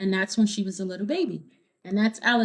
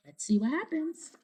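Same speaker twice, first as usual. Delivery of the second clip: usual speaking voice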